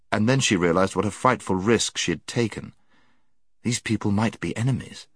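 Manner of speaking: ordinary delivery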